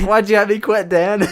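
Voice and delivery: laughing mock whine. Mock quote of his friend, laughing.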